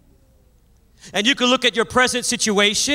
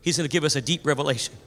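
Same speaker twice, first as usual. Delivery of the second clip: mock congregation aside; 8 semitones lower, 6 dB quieter, faster than usual. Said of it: "turn to the person next to you, smile, and say".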